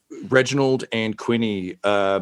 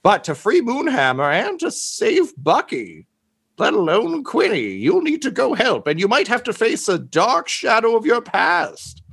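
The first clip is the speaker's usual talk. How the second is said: higher, urgent guide figure voice; a lawyer-world figure briefing the heroes, pitched up; high character voice; 12 semitones higher than usual. Acted. GM voicing a helper, high character voice.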